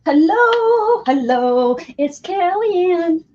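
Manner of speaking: high, loud greeting calling out hello; excited sing-song welcome. Real, not acted. Sing-song greeting to arriving viewer.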